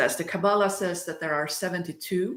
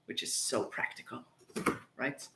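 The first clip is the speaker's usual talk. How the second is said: much softer, half-whispered; whispered aside; 6 semitones lower, 14 dB quieter than usual. Real, not acted. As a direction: drop to a sly whisper.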